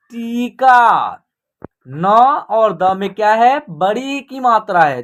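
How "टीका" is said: टीका very slowly, ee held long; long vowels. Shown as a demonstration. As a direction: stretch both vowels of टीका. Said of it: "खींच करके होगा".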